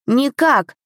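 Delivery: put-on high, sudden childish protest; a little prince cutting in; abrupt blurt. A narrator's reading. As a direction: blurt it out sharply. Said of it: "резко выпалил кронпринц".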